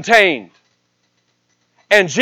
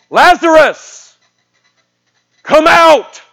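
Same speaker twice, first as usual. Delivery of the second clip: loud, high, drawn-out commanding call; yelling out; 9 semitones higher, 6 dB louder, slower than usual. Retold or imitated. Jesus calls dead Lazarus from the tomb, yelling out. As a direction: call the name out slowly at full voice. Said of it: "And he yells out".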